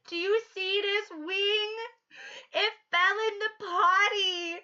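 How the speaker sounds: put-on high, slow child voice; hammed-up little girl. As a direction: sound like a small earnest girl. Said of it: "And she goes".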